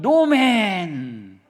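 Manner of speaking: a playful mock of amen turned sour; mock no-men. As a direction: drag it out as a sulky refusal. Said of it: "조금만 고난이 오면".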